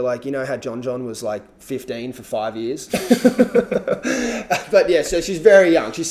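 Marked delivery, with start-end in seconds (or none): 2.9-4.6 high bright burst of laughter; a real laugh. Real, not acted. Laughing at John John joke. 4.7-6.1 laughing. Real, not acted. Still laughing after joke.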